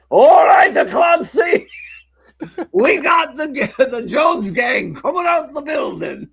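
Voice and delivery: high shouted hammed-up Irish cop accent; terrible Irish accent. Late-night cop on the police radio, terrible Irish accent yelled. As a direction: yell a bad Irish accent over the radio. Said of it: "bust into a terrible Irish accent".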